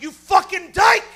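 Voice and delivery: high, explosive acted yell; shouted insult. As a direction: hurl it at the top of your voice. Blurts insult in retold fight.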